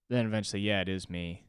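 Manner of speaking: normal talking voice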